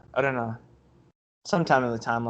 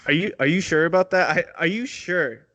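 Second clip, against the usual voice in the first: rising, pleading, higher and higher; panicked caller pitching up; desperate customer; 4 semitones higher than usual. Pretend. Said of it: "let's play it out".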